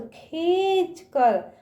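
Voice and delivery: खींच कर slowly, ee sounded through the nose; nasalised long ee. Shown as a demonstration. Teacher explaining the dot to children, खींच with long nasal vowel. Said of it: "नाक से निकलता है".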